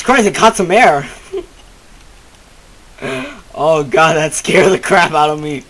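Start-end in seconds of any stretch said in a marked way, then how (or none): none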